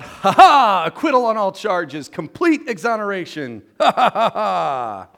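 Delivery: triumphant yell with gloating laughter; high, very loud cartoon crowing, laughing out the ha-has; Calvin. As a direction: shout it like a gleeful kid cleared of everything. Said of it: "and he yells out".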